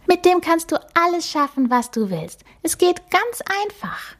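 a cute magic mouse introducing herself; little mouse voice. Acted. The magic mouse introduces herself to Emma, high and squeaky.

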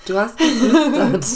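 loud call, stress on geflüstert. Real, not acted. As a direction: say it loudly across the room. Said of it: "Hä?".

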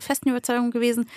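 ordinary talk

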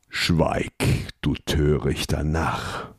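low, whispered menacing rebuke; evil voice. Acted. Creature silencing the shouting prince, evil whisper. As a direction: silence him with a hissed, evil command. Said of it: "die böse Stimme der Gestalt".

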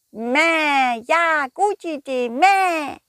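high, loud bleating sheep; excited sheep voice. Acted. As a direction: cheer like a happy sheep. Sheep agrees.

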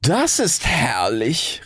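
slow, high, relished exclamation; exulting. A narrator's reading. Rocket delighted by the fire, slow and high. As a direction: stretch it out grandly in delight. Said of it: "rief die Rakete".

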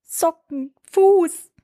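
put-on high child voice, one word at a time; small high halting voice; imitating toddler son. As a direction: mimic a toddler working it out. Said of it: "meint dann so zu mir".

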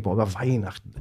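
low, soft aside to oneself; subdued, mildly dismayed mumble; dismayed inner thought. Retold or imitated. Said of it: "Ich denk".

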